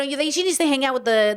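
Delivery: normal talking voice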